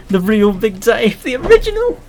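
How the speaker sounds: rising to a squeaky falsetto; dramatic high voice. Pretend. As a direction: ham it up, climbing into a squeak. Hammed-up quest for Big Dave.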